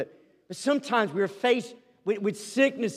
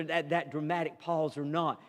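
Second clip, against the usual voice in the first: normal talking voice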